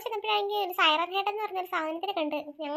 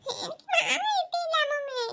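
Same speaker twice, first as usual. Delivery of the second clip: higher, slow blubbering complaint; whiny crying; 7 semitones higher than usual. Acted. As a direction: wail it like a sulking child. Boy crying for mango. (കരഞ്ഞുകൊണ്ട് പരാതി പറയുന്നു)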